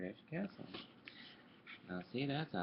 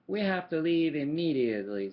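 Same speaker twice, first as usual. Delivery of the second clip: urgent and much louder; worried; 3 semitones higher, 10 dB louder than usual. Acted. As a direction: blurt it out in a worried rush. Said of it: "said Donald, looking worried".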